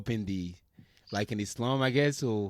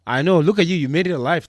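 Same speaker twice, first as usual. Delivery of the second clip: excited teasing; 6 semitones higher, 11 dB louder than usual. Real, not acted. Teasing amazement at surviving rough school.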